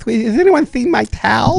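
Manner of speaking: put-on high, slow lisping boy; lisping kid voice. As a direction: do the kid with a lisp. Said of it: "a little lisp that he goes".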